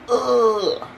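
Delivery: weary groan. Retold or imitated. Tired traveler venting at the missed bus, weary groan. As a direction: let out an acted tired groan. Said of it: "I'm tired and I'm just like".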